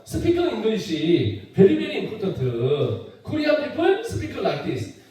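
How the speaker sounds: slow mock Korean English; Korean accent. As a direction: imitate a Korean speaker's English. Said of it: "한국 사람 나와서". (느린 한국식 영어 흉내)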